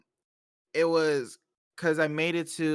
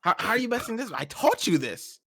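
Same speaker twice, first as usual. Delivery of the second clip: higher-pitched mock disbelief, dropping back down; exasperated coach dad; 7 semitones higher than usual. Coach dad to kid messing up, exasperated. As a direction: play at scolding a pupil. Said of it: "he gonna be his coach".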